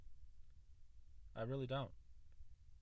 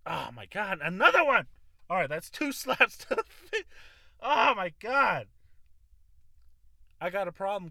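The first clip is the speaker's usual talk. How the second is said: much louder and higher, laughing in dismay; loud exasperated outcry; 9 semitones higher, 14 dB louder than usual. Real, not acted. Loud laughing dismay at cussing again. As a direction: cry it out, half laughing at yourself.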